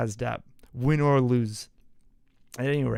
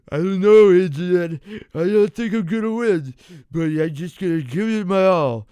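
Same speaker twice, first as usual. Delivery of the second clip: terrible Stallone; 4 semitones higher, 8 dB louder than usual. Retold or imitated. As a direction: do a bad Stallone, slurring and loud. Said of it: "very terrible Stallone".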